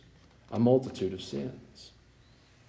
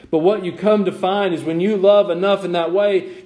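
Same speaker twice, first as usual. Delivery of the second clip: loud, higher-pitched sermon delivery; impassioned preaching; 7 semitones higher, 6 dB louder than usual. Real, not acted. Preacher urging congregation to love those who wrong them, impassioned. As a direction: preach it out with rising force.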